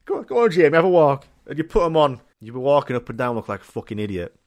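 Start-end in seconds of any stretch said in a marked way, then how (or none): 0.1-1.3 high, loud mum voice; pushy mum. Acted. Mum coaxing Jamie to test boots, pushy and high. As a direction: urge the child on, pitched right up. Mum urging child in shoe shop.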